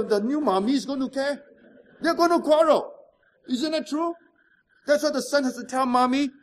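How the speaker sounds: normal talking voice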